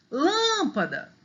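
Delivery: LÂMPADA with a high, drawn-out LÂM; calling the word. Shown as a demonstration. Teacher showing first-syllable stress, calling LÂÂMpada. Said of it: "o lã é mais forte".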